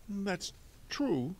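high-pitched, fussy banker; hesitant Mister Poe. Acted. Banker conceding the boy's point, hesitant.

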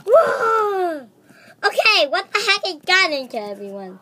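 loud startled whoa, then fast; startled exclamation, rushed; surprised shout. Pretend. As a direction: blurt it out, caught off guard. Startled by chaos.

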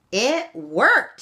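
high, loud excited shout. A narrator's reading. Sean shouting to his aunties that the trap worked. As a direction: shout it out with glee. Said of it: "shouted Sean".

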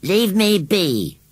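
high, slow, angry grumble; sulky scarecrow. A narrator's reading. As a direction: drag it out in a huff. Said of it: "He shook himself angrily".

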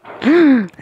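high, loud startled gasp; sharp gasp. Real, not acted. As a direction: gasp in sudden surprise. Sudden realization in game.

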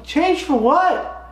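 very high, drawn-out mock bewilderment; baffled questioning. Golfer asking cart girl about change, comically baffled. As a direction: squeak out a confused question. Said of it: "I'm like".

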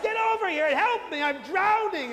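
high, loud cry for help, retold; panicked call for help. Yelling at the young priest from the water, mock panic. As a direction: yell to the priest as if going under. Shouting to priest while drowning.

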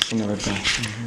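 ordinary delivery

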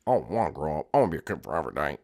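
put-on low, soft childish Jeffy voice. Jeffy answers his parent, low sulky kid voice. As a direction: mumble it like a stubborn kid.

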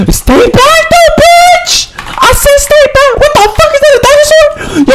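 very high-pitched, frantic yelling; real panicked squeal; panicked shriek. Real, not acted. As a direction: shriek a warning in alarm. Panicked warning, then spots dinosaur.

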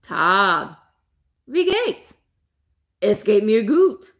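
lightly voiced puppet lobster speaking German. Host playing the lobsters as puppets talks German, playful puppet voice. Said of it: "We could have a puppet show".

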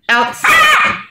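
very high sing-song call to a dog; high-pitched call. Real, not acted.

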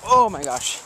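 startled, the words pushed out; surprised gasp. Real, not acted. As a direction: caught off guard, "Oh my gosh" blurted out. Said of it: "I say, "Oh my gosh," way too much".

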